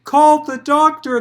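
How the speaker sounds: loud, high urgent call; urgent loud proclamation; proclaimed loudly. Acted. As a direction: call out for the doctor in alarm. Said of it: "the partner proclaimed".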